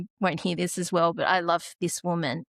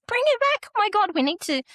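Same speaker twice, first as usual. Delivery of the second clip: much higher, louder urgent command; urgent alarm; 6 dB louder, much faster than usual. Retold or imitated. Nurse ordering the mother to bring the baby, alarmed. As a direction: imitate a nurse snapping into emergency mode.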